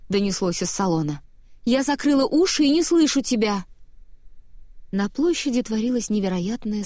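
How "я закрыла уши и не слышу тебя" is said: high, loud cross retort; exasperated shout, pitched well up; indignant call. A narrator's reading. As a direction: shout him down, refusing to listen. Quarrel, storming out of carriage.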